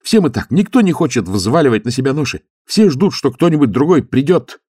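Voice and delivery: high, loud zealous youth; eager young monk. Acted. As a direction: lift the voice, earnest and keen. Youth continues, fired up.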